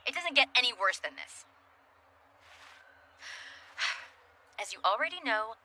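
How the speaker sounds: ordinary talk, nothing marked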